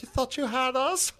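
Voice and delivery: very high comic villain taunt; hammed-up radio villain; mock Nazi captain. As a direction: taunt the enemy in a silly high voice. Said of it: "getting on their radio and being like".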